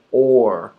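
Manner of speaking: or said alone, clear. Shown as a demonstration. Teacher showing a familiar word, clear. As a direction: say or with a curled R. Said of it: "The R changes it".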